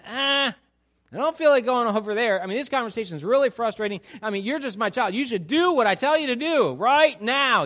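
impatient parent. Retold or imitated. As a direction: snap at the kid, fast and fed up. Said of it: "short circuit God's ideal by saying".

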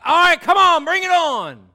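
high, full-volume hyped-up yell; cocky challenge. Acted. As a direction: roar it like you welcome the fight. Acts eager, cocky Job.

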